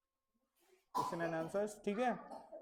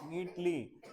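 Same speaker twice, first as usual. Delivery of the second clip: teacher instruction; 3 semitones higher, slower than usual. Acted. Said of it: "मैं इस बच्चे को बोल रहा हूं".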